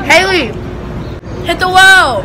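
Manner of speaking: calling out loud and high; shouting. Real, not acted. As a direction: yell across to her friend. Calling out to friend on ride.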